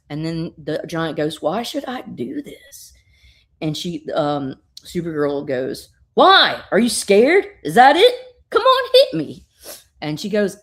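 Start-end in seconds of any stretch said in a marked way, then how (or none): 1.4-2.9 hushed, unvoiced question; whispered. The giant asking Supergirl why she should hit her, whispered. 6.1-9.5 loud, high taunting yells; goading shout. Retold or imitated. Supergirl daring the giant to hit her, shouting.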